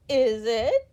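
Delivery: chuckling. A narrator's reading. Mister Happy chuckling back at Miss Giggles, teasing. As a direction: laugh the question playfully. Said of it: "chuckled Mister Happy".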